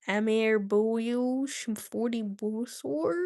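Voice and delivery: slow, lightly Bengali-accented phrase; mock Bengali accent for chat. Shown as a demonstration. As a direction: try a Bengali accent, slowly. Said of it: "in your Bengali accent".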